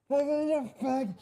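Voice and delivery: high, soft far-off yelling; thin, high imitation of someone screaming; imitated distant screaming. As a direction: mimic muffled screams from a distance. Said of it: "I just hear this".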